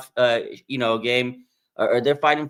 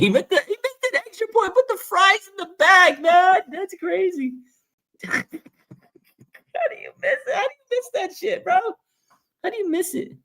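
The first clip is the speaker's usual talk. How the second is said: squeaky high voice cracking with laughter; laugh-cry at how bad the miss was; laughing disbelief. Real, not acted. Mocking a missed extra point.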